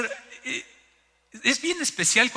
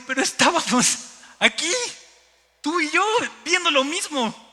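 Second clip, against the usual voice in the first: incredulous protest; 10 semitones higher, 4 dB louder than usual. Retold or imitated. Husband disbelieving that wife missed it, incredulous protest. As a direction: protest loudly in separate chunks.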